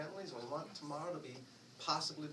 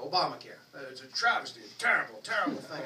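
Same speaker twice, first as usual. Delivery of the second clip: loud, outraged grumble about Obamacare; mocking voter; 8 dB louder than usual. Mimicking a voter who hates Obamacare, mock outrage. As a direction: ham up the indignant conservative.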